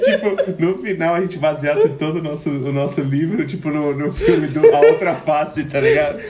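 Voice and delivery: giggling through the words; laughing. Real, not acted. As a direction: laugh while you talk. Giggling over a silly idea.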